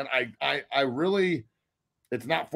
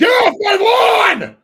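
high, loud, slow cranky yell; old man yelling; 12 dB louder, much slower than usual. Pretend. Mocking an old man yelling at the clouds from his porch. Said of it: "old man on the porch yelling at the clouds".

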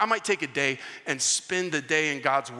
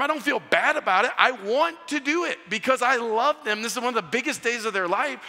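higher and louder, pressing hard; emphatic insistence; 6 semitones higher, 4 dB louder than usual. Retold or imitated. Uncle insisting to his sister he wants to give, emphatic and raised. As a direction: push back hard, all heart.

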